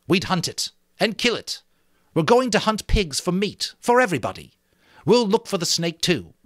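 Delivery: a boy leader waving away the snake fears, lightly voiced; Jack, cocky and dismissive. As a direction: brush off the beast talk with swagger. Jack speaks to the assembly.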